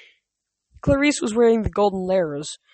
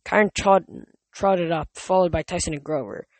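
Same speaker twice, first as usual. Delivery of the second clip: plain reading voice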